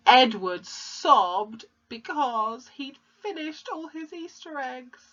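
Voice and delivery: hammed-up tearful wail, slow and high; mock sobbing. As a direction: drag it out high, fading as if crying. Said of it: "sobbed means cried".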